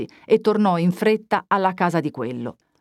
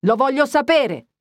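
high, forceful narrated shout; shouting louder; 8 semitones higher than usual. A narrator's reading. Young man shouting at the priest to name the culprit, loud. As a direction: demand it at full voice. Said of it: "gridò ancor più forte il giovane".

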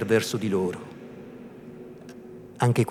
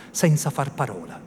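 ordinary delivery

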